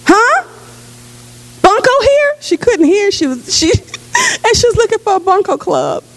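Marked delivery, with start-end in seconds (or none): 0.1-2.3 put-on much higher, puzzled old lady; lightly voiced deaf lady asking; confused lady. Hard-of-hearing lady retold.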